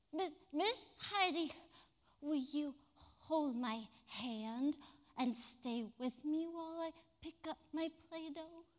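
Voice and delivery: a hint of a shy small boy; timid child. Boy asks teacher to stay with him, timid.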